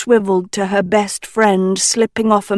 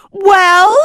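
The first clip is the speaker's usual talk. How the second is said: much higher, loud demanding bellow; 12 semitones higher, 7 dB louder than usual. Acted. Sophie demanding Nicola answer, bellowing. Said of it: "Sophie bellowed".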